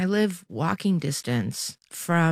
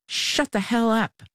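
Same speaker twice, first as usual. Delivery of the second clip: mild narrated yell; 5 semitones higher than usual. A narrator's reading. Woman on the bus to a crying girl, restrained yell. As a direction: read a yell with lifted pitch, held back in volume. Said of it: "she yelled".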